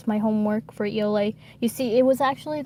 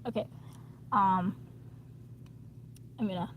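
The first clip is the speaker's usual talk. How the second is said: talking while chewing gum; words round a wad of gum; 6 dB quieter, faster than usual. Real, not acted. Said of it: "stop chewing gum for a moment".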